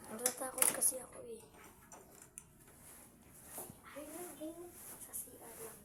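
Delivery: whispering. Real, not acted. Hiding, scared, whispering.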